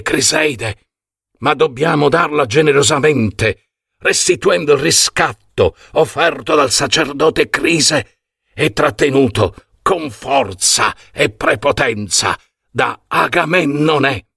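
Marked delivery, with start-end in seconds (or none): none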